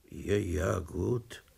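soft, bored brush-off; sleepy interruption. A narrator's reading. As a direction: cut him off half asleep. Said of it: "unterbrach ihn die verschlafene Stimme". (verschlafen und leise abwimmelnd)

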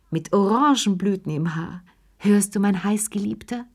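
ordinary delivery

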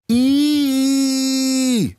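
put-on high shrill "Ih!" of startled kids; children's shriek. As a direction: squeal like kids jumping from water. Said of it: "schreien die Kinder".

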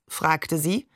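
plain reading voice

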